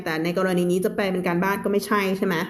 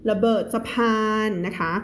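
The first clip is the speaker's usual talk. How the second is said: ordinary talk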